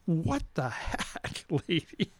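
acted dumbfounded reply; mock bafflement. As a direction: drag the words out in disbelief. Acted baffled reaction to screaming woman.

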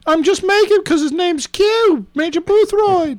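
high British character voice; British, pitched well up; British accent. Acted. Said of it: "so he's British?".

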